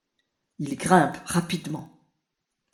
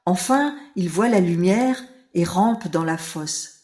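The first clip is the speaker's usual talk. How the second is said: usual speaking voice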